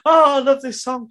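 high, loud excited gush; gushing fan. Retold or imitated. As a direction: rave about a favorite song. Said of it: "being like".

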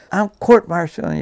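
mock yell of a threat; retold scream. Sergeant threatening court-martial, mild mock yell. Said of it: "He screamed".

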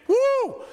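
high whoop of a fan; a quick excited woo from the stands; hammed-up woo; mock cheer.

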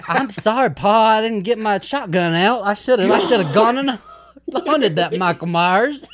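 loud sarcastic apology with a laugh. Pretend.

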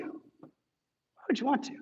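soft slow repeat. Real, not acted. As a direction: let the question linger quietly. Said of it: "repeated softly and slowly".

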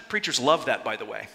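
dry sarcastic aside, dropping low. Acted. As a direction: say it with deadpan sarcasm. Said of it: "Sense my sarcasm".

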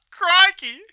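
excited charity campaigner. Retold or imitated. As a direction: shout it like a street fundraiser. Imitating charity street campaigners.

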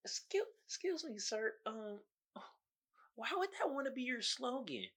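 high, soft, timid caricature. Acted. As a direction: put on a meek, high-pitched objector.

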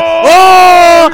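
very loud, high, long wail; comic uncontrollable outburst; sudden cry mid-sentence. Acted. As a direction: break off into a howling "ohh". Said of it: "he's like".

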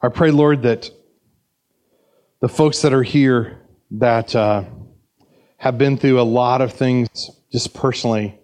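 steady, earnest pastoral prayer. Acted. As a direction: pray over the congregation gently. Closing prayer.

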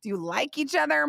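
ordinary talk

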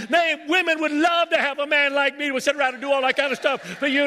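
he lists every chore he's quitting in a high shouted rant; fed-up husband rant. Acted. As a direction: play the husband who's done with chores, hammed up. Preacher acts a fed-up husband's rant.

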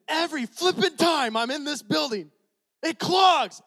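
high, loud angry yell; retold temper flare at the clog; frustrated outburst. Pastor losing his temper over the toilet, yelling high and loud. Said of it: "I did this. I said".

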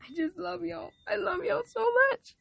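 high, tearful catch in the voice; real tears to the listeners; voice cracking with emotion. Real, not acted. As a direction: let it break as you say how much you love them.

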